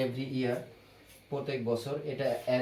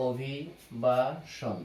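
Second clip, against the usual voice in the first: slow dictation; much slower than usual. Shown as a demonstration. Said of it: "teacher repeats the word slowly".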